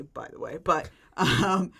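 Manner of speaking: ordinary delivery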